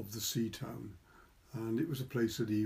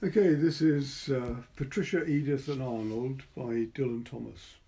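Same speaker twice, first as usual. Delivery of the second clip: usual speaking voice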